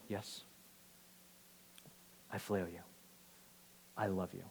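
soft, low confession; humble sincerity. Acted. Peter answers Jesus the third time, soft and humbled. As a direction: answer quietly and low, with long pauses.

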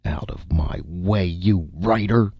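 blustering director's restrained roar. A narrator's reading. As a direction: spit the last word as an insult. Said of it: "roared Saint Cyr".

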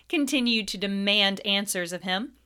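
ordinary delivery, nothing marked

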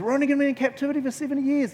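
fast, high-pitched excited reading; sudden realization. Retold or imitated. Daniel reading Jeremiah's prophecy, suddenly excited. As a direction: rush it out high as the discovery dawns. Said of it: "he suddenly realizes".

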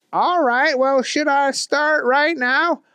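high, eager goofy voice; keen simple fellow; high dopey voice; Booger voice. A narrator's reading. Booger asks to start.

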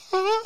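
very high squeaky sob-whimper; mock crying whimper. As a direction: whimper like a kid crying on the phone. Crying on phone to parents.

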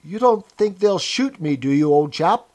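anxious appeal, tags rising for agreement; eagerly appealing. A narrator's reading. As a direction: plead for reassurance from an old chap. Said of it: "began eagerly appealing to him".

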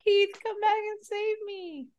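high, slow, hammed-up damsel in distress; mock damsel voice. Friend mocks the trapped girl, high slow damsel whine. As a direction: whine it up high like a helpless heroine.

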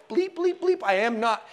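high-pitched bleeped-out swearing; mock cussing. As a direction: imitate a censored rant of denial. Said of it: "he starts cussing to make his point".

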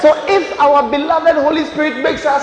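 loud preacher's shout, key words stretched; full-force preaching. Real, not acted. Preacher challenges the congregation about their beloved, full-force preaching. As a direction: drive it home to a roaring congregation.

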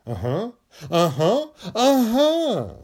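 playful growing eagerness; mock hope building. Shown as a demonstration. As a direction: talk yourself into hope. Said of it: "Feel hope and say".

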